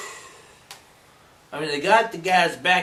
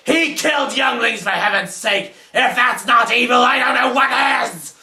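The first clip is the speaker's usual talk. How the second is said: high, loud, outraged rant; indignant outburst; 8 semitones higher, 6 dB louder than usual. Acted. Master defending his apprentice Darth Vader's evil, indignant shouting. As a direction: build to an exasperated shout.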